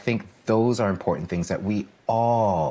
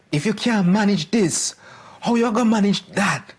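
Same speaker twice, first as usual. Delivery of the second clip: high, loud Jamaican voice; Jamaican accent; 14 semitones higher, 5 dB louder than usual. Retold or imitated. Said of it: "my poor Jamaican accent".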